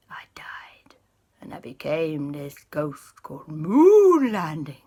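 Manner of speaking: breathy haunted whisper; ghostly whisper. Acted. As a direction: whisper your own death. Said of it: "The ghost went".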